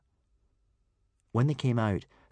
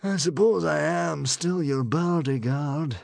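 raised, louder, grudging character voice; reluctant bodyguard; 8 semitones higher, 5 dB louder than usual. A narrator's reading. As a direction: concede your duty reluctantly.

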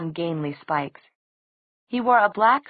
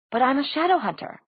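much higher, indignant objection; high protest; 8 semitones higher than usual. A narrator's reading. Clary objecting to Magnus's refusal, high-pitched protest. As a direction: object in a raised, youthful-pleading pitch.

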